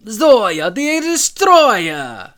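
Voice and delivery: put-on Zoya Russian accent. Proclaiming her villain name to her co-host, in a booming Russian accent. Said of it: "I still say your Zoya".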